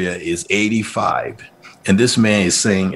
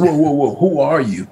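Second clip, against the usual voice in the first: startled surprise; 6 semitones higher than usual. Retold or imitated. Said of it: "I almost lost it".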